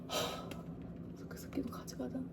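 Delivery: conspiratorial whisper; hushed scheming aside. Retold or imitated. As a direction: whisper it like sneaking off. Sneaking out through window.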